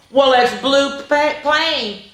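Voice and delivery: plain reading voice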